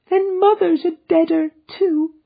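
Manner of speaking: put-on high, loud childlike cry of grief; a small girl breaking into sobs; crying little girl. A narrator's reading. Little girl learning her mother died, crying out. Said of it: "cried the little girl ... sobbing bitterly".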